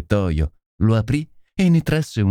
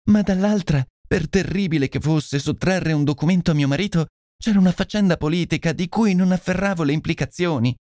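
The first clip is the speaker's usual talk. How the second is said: higher-pitched lady explaining herself; distressed confessing lady; 7 semitones higher than usual. Acted. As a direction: confess anxiously, appealing for understanding. (signora turbata che si confessa)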